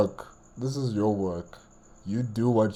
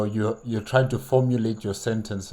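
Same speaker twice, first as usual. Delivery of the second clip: usual speaking voice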